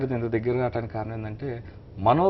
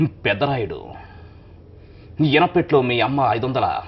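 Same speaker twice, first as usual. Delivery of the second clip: Rajinikanth impression; 4 dB louder than usual. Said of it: "ఆ dialogue ఎలా ఉంటుంది?". (రజనీకాంత్ గొంతులో నెమ్మదిగా డైలాగ్)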